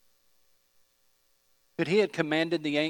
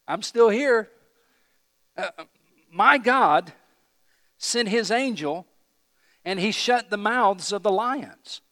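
raised voice, loud and high; calling back; 8 semitones higher, 8 dB louder than usual. Acted. Daniel telling the king he survived, calling out. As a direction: shout the news up from below. Voicing Daniel's answer from den.

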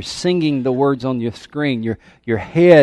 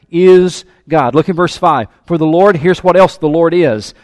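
loud, driving preacher's voice; fervent sermon delivery; preaching at full force; 4 semitones higher, 6 dB louder than usual. Acted. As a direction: preach it out to the church. Preaching to congregation.

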